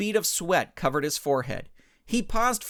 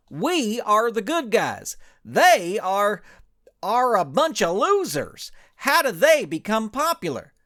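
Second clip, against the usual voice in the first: slow, higher, louder put-on ranch hand voice; indignant cowboy hero; 7 semitones higher, 6 dB louder than usual. Ranch hand complains to his partners, indignant and loud. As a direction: puff up as a wounded hero cowboy.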